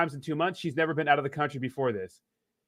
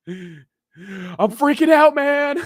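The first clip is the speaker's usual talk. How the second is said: mock panic; 8 semitones higher, 7 dB louder, much slower than usual. Panicked attendant.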